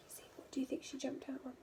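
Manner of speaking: fast hushed whisper to the class; whispered question. A narrator's reading. Teacher whispering a question to the listening children, hushed. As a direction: lean in and whisper it quickly.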